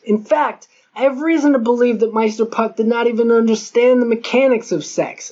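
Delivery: plain reading voice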